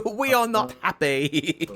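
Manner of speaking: high, slow mock-huffy quote. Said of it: "the one Sky does".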